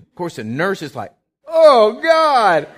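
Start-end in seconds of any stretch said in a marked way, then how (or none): 1.5-2.7 loud, high mock scream of alarm; big hammed-up exclamation; startled shriek. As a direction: imitate a nurse crying out in shock. Startled nurse's cry acted out.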